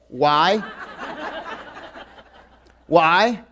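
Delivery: ordinary talk, nothing marked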